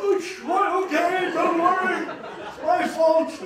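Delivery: high, loud, laughing reassurance; reassuring him. Retold or imitated. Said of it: "and I".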